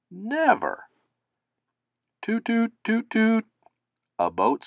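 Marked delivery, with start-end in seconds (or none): 0.1-0.9 emphatic never. Acted. Everyone dismisses the old boat. 2.2-3.5 high, flat tooting like a ship's horn; boat horn toots. Acted. A boat saying hello to the river, tooting.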